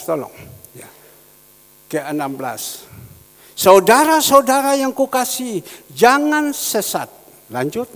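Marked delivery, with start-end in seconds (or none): 3.6-7.1 loud, high, declamatory reading; scripture proclamation. Acted. Verse read out for the congregation, loud and declamatory. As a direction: ring it out to the whole church.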